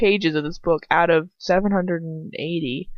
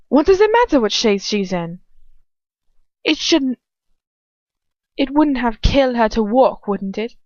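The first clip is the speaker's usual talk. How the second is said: indignant English villainess, pitched up; Bellatrix; 4 semitones higher, 4 dB louder than usual. A narrator's reading. Arguing with Lucius about walking, furious. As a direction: snipe back in a high English accent. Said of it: "Bellatrix asks furiously".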